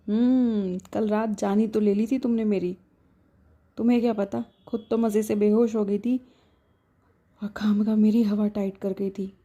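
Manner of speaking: usual speaking voice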